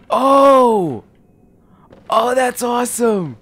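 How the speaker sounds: loud, high burst of delight; amazed whoop, high and loud; thrilled. Real, not acted. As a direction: cry out in real excitement. Delighted by weapon transformation.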